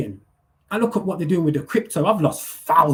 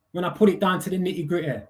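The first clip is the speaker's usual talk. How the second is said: ordinary delivery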